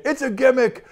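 put-on a light New Jersey accent, brushing it off; imitating a Jersey agent scoffing, with only a hint of New Jersey accent. Retelling a sceptical New Jersey agent, light New Jersey accent. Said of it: "trying to do my best New Jersey accent".

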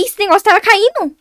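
exclamação assustada, mais aguda e alta; susto. Real, not acted. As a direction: solte um susto alto e agudo. Susto com objeto caindo no jogo. (susto repentino)

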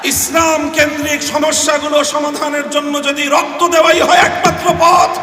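fervent shouting preacher; fiery preaching. Real, not acted. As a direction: thunder it out to the crowd, building. Preaching at full force.

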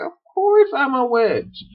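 high-pitched put-on witch voice; hammed-up, high witchy retort; exasperated witch. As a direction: state the obvious as a comic witch. Acted witch answers Edmund.

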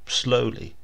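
plain reading voice

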